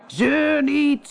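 high, sharp shout; captain shouting. Acted. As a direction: brush off the innkeeper with a yell. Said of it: "schreeuwde de kapitein".

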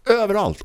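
överallt stretched and pitched high; high, emphatic överallt for comic exaggeration; heightened emphasis on överallt. Real, not acted. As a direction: hammer home överallt with a high-pitched first syllable. Repeats word for comic emphasis.

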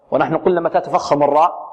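ordinary talk, nothing marked

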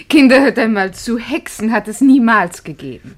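mother raising her voice to stop the witch talk. Acted. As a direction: say it loud and final to the children. Mutter ruft Kinder zur Ordnung. (Mutter zu den Kindern: laut und bestimmt)

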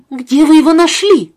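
put-on high, loud eager boy; eager question. A narrator's reading. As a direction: ask it bursting with curiosity.

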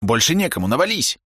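high urgent call to push; rally them, pitch rising high; urgent command. Acted. Thug urges others to push.

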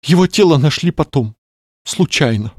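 high, plaintive widow-to-be; grieving lady voice. Acted. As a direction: lament it like a theatrical mourner. Clarissa mourning fiancé. (скорбящая дама)

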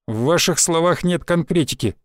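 ordinary delivery, nothing marked

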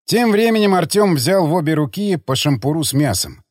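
plain reading voice

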